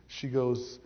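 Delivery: ordinary talk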